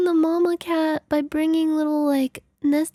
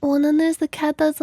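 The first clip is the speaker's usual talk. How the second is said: ordinary talk, nothing marked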